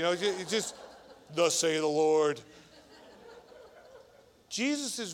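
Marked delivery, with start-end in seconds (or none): 1.3-2.4 God voice. Pretend. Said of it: "threw on his God voice".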